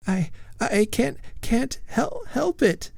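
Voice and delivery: high, broken, mildly sobbing words. A narrator's reading. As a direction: choke out the words through light sobs. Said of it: "sobbed Lady Follett".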